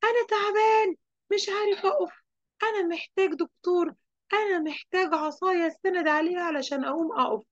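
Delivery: whiny high wail, acted for a story; mock pained cry. Storyteller voices fallen Tanween Al-Fath begging for help, high mock wail. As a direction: plead in high-pitched make-believe agony. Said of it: "بقى يصرخ".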